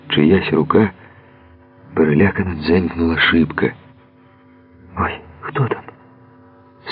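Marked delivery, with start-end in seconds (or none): 5.0-5.8 startled fear. A narrator's reading. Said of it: "скочила на долівку Мирослава".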